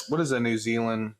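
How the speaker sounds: ordinary talk, nothing marked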